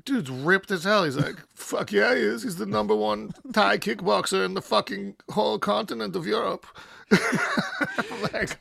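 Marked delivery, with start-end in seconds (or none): 1.5-6.7 put-on proud German accent, higher. Frieder bragging about his friend in a German accent. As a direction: boast it with German pride. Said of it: "these German accents are great".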